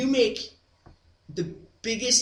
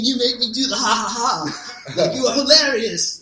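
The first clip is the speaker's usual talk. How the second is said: higher and louder, an awed admirer's voice; exchange student voice; 7 semitones higher, 8 dB louder than usual. Retold or imitated. To the laugh team, the exchange student gushes in awe, higher and louder. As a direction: gush at them like a starstruck foreign student. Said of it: "I won't do an African accent".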